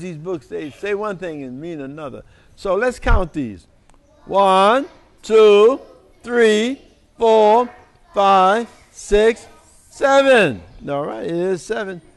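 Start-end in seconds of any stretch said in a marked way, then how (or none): 4.3-10.6 louder counting call; counting aloud. Shown as a demonstration. Reader counting pizza boxes with the children, louder. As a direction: count each one out to the crowd.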